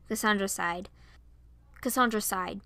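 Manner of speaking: usual speaking voice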